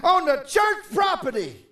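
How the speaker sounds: mock indignant. Self-righteous member scolding a smoker at the door, mock outrage. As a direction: huff like an offended church member. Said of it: "A self-righteous person will get mad".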